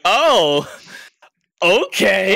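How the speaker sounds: high, loud mock surprise; wide-eyed "oh, okay"; surprised reaction. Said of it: "they're gonna kinda give you that".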